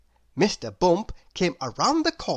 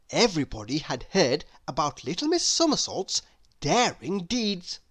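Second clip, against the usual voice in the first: ordinary delivery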